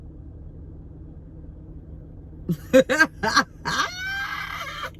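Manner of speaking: high, wild cackling laugh; real high-pitched cackle; shrieking laugh. Real, not acted. Bitter laughter after rant.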